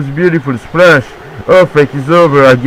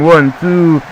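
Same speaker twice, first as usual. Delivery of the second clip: usual speaking voice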